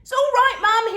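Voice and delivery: put-on high loud boyish reassurance; reassuring boy. A narrator's reading. Son reassuring mum, high boyish voice. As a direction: soothe mum brightly. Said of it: "he reassured".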